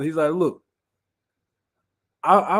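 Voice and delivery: usual speaking voice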